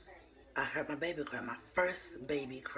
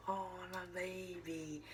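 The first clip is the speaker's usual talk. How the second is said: put-on soft, slow, higher, moved "oh my baby"; tender coo; 5 semitones higher, 6 dB quieter, slower than usual. Mother hearing her firstborn cry, softly cooing. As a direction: coo it like seeing your newborn.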